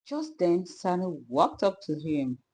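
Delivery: plain reading voice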